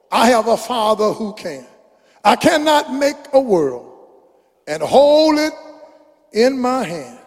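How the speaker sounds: rising, soaring preacher chant of the lyrics; preaching a song. Acted. Preacher reciting a song to the congregation, soaring chant. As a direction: build it up, let it swell.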